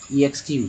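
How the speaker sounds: ईएक्सक्यू with a full "ee" before "ex"; wrong spelling-out pronunciation. Shown as a demonstration. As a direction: sound out ईएक्सक्यू wrongly, starting with ee. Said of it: "ऐसे थोड़ी बोलते".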